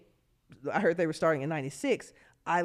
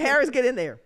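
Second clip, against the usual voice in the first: loud coach's command; coach calling out; 7 semitones higher, 6 dB louder than usual. Retold or imitated. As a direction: bark it like a coach sending a player in.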